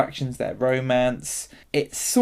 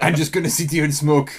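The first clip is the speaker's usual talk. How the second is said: put-on French accent. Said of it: "we managed to offend Eastern Europeans and the French".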